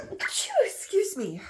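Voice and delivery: slow, sneezing out the words. Real, not acted. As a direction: excuse me through a sneeze. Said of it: "oh, I think I'm gonna sneeze".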